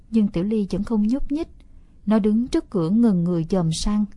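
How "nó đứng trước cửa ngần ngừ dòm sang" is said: ordinary delivery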